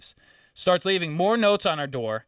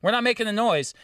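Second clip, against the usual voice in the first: high, insistent mock yell; retold screaming; 7 semitones higher than usual. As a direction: protest as in a retold shouting match. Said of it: "And I was screaming at".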